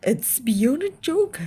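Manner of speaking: father protesting. Acted. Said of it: "said Mister Gloop".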